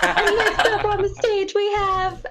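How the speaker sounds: high hyped announcer intro. Retold or imitated. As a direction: hype the crowd like a club DJ. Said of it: "in a D J voice".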